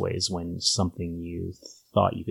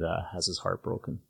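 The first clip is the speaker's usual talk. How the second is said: normal talking voice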